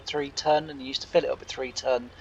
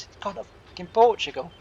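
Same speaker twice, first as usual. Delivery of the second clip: higher-pitched mock disbelief; imitated exasperation; 6 semitones higher than usual. Imitating Anthony griping after hanging up, exasperated. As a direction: act out his mild outrage, pitch lifted.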